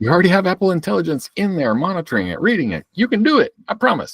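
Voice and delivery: high-pitched, pleading encouragement; playful coaxing. Pretend. Co-host urging that it can do it, playfully coaxing. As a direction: coax it along in a high, singsong pep talk. Mock coaxing of the phone assistant.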